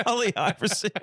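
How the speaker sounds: high-pitched, giggly "Ali Iverson"; cracking up on the name; laughing repeat. Retold or imitated. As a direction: squeeze the name out through laughter. Repeats the aunt's guess while laughing.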